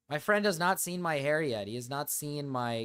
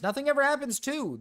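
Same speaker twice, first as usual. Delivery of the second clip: very high, raised-voice catchphrase; mock exclamation; 11 semitones higher, 4 dB louder than usual. Streamer echoes a chat catchphrase, mock exclamation. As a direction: sing it out high in mock outrage.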